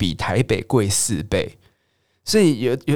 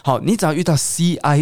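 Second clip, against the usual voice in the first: normal talking voice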